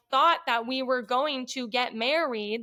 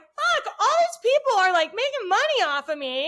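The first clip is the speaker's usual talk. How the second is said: high, loud burst of exasperation, easing off; retold indignant realisation; exasperated outburst; 8 semitones higher, 5 dB louder than usual. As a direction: burst out high on the swear, then come down. Acts out her exasperated realisation.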